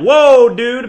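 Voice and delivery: loud, high startled whoa; shocked patient yelling whoa dude; alarmed patient. Retold or imitated. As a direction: cry out in alarm at the surgeon. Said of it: "you'd be like".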